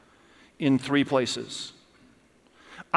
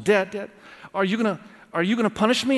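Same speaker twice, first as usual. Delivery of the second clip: higher, hurried pleading of a crying son; mock a tearful little boy, pitched up and rushed; imitated bawling child; 5 semitones higher, faster than usual. Son asks dad about punishment, imitated tearful pleading. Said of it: "he's crying and he's bawling".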